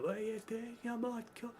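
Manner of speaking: high, strained Scottish fan yelling at the pitch; angry Scotsman. Retold or imitated. Fan shouting at the player on the right wing, mock angry Scotsman. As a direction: imitate a raging Glaswegian in the stands. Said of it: "you just hear some pure angry Scotsman like".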